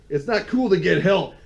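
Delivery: high, loud scolding quote; Rocket. Acted. Fan quoting a film line to co-host, loud mock scolding. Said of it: "Rocket says to him".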